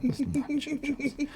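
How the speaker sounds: a soft, hammed-up British accent. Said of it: "as we're talking in this British accent".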